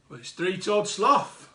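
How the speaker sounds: usual speaking voice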